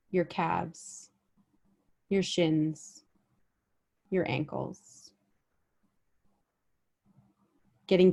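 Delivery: unhurried meditation guide, long pauses between phrases; guided body scan meditation. Real, not acted. Guide helping listeners notice their bodies with each breath, guided meditation. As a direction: lead them gently through the body, letting each phrase settle.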